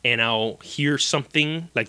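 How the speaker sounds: ordinary talk, nothing marked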